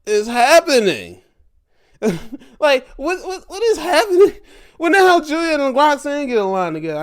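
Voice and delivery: high, loud and fast, half laughing; high, fast, laughing disbelief; shocked disbelief. Real, not acted. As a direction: shriek in disbelief at the TV. Shocked by the attack.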